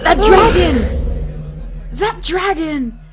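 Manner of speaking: slow, loud, high cry of awe; hyped exclamation. Real, not acted. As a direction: savour every word like a fan. Hyped reaction to the dragon.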